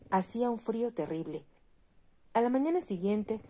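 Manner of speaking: usual speaking voice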